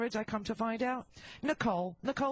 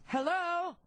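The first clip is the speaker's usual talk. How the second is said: answering the phone; 7 semitones higher than usual. Pretend. Answering the pretend phone call.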